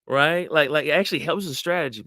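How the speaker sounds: ordinary delivery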